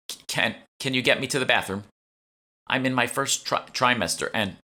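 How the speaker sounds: high, halting urgent plea; stammering plea. Acted. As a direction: stumble over it like you're about to be sick. Stammering fake pregnancy plea.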